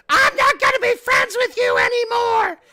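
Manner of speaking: hammed-up high shriek into the mic; mock scream. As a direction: shriek it like a furious kid on stream. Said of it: "screaming in the microphone".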